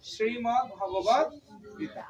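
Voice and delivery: শ্রীমৎ Bhagavad Gita with শ্রী on a curled-back tongue, slow; retroflex shri, slowly. Shown as a demonstration. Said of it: "জিহ্বাটা উল্টিয়ে". (জিভ উল্টিয়ে ধীরে শ্রীমৎ)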